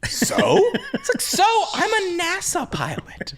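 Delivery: high-pitched acted comeback; half-laughing, high mock protest; mock indignant retort. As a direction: play an incredulous, affronted retort.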